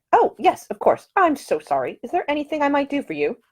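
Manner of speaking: put-on a polite, earnest young Englishwoman; young lady's voice. A narrator's reading. The lady apologises eagerly.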